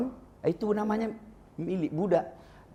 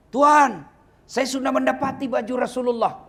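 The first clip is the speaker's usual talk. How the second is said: joyful report; 11 semitones higher, 6 dB louder than usual. Acted. Budak gembira lapor tuannya.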